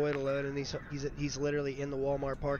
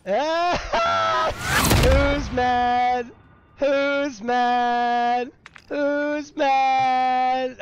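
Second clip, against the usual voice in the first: loud, high gloating taunt; 9 semitones higher, 8 dB louder, much slower than usual. Real, not acted. Gamer taunting the enemy team after winning, loud gloating. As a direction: rub it in, laughing.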